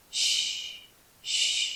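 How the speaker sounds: soft breathy shush. Acted. Father softly shushing his baby.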